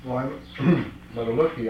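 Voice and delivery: ordinary talk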